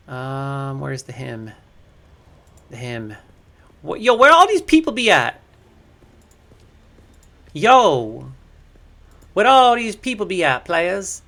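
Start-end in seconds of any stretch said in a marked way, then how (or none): 3.8-5.4 loud, high call across the server. Real, not acted. Streamer calling out for his absent community, loud and high. 7.5-11.2 loud, high call across the server. Real, not acted. Streamer calling out for his absent community, loud and high.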